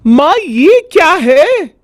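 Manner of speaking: put-on high, curious girl's question; a little girl asking her mom; young daughter voice. Daughter asking mother what this is, curious.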